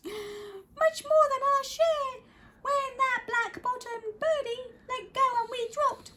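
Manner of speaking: very high little voice pleading; tiny Who mayor pleading. Acted. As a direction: plead up from the speck. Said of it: "pleaded the voice".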